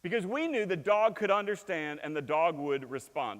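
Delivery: ordinary delivery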